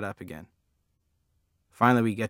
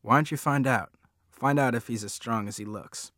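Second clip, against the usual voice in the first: plain reading voice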